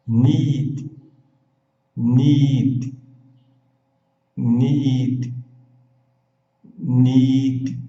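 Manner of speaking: Need with a long ee and a dry D, no vowel after; Need: long ee, dry final D. Shown as a demonstration. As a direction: stretch the ee in Need, cut it on D. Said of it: "o detalhe do I, que é longo, e o D seco".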